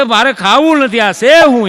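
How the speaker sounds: mock indignant refusal. Husband's quarrel over wife's cooking. (થાળી દૂર કરતો પતિ, ઊંચા અવાજે નારાજ ઇનકાર)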